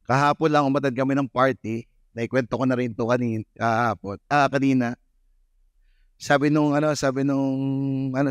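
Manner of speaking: usual speaking voice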